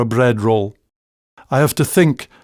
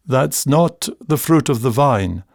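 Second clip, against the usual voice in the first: usual speaking voice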